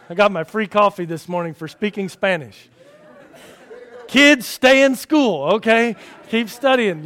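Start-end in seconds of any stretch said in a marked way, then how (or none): 4.1-7.0 slow, higher, louder mock lecture to kids; mock-solemn advice. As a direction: play the wise elder, tongue in cheek.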